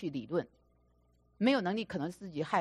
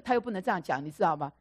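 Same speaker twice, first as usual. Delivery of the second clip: ordinary talk, nothing marked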